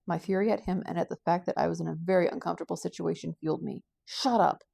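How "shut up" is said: sharp, loud shout with falling pitch; shouted angry command; angry outburst. Acted. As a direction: snap it out loudly in fury. Furious outburst at her captor.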